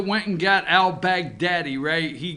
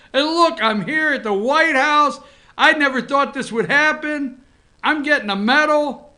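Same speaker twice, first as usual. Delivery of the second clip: higher, thrilled pooch boasting; excited dog voice; 6 semitones higher than usual. Acted. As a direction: play the dog thrilled at his medal, pitched up. Voices dog addressing Mom at home.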